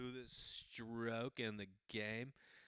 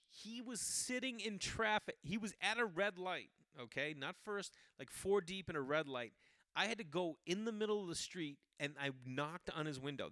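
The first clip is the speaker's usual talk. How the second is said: pitched-up incredulous rant; exasperated rant; 7 semitones higher, 4 dB louder than usual. Real, not acted. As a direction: vent to the audience, voice up high. Exasperated ranting.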